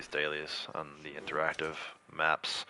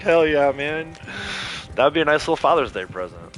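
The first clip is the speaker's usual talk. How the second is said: retold enthusiasm; 7 semitones higher, 6 dB louder than usual. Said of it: "I was like".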